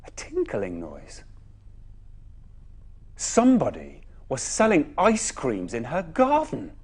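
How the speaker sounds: usual speaking voice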